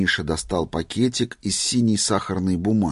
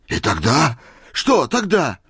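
eager urging; 5 semitones higher, 3 dB louder than usual. A narrator's reading. Old man impatient for what happened, eager. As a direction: question climbing high.

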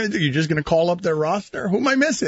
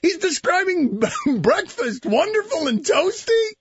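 laughing disbelief; 10 semitones higher than usual. Real, not acted.

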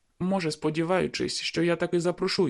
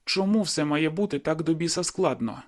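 plain reading voice

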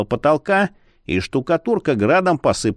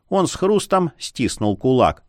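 ordinary delivery

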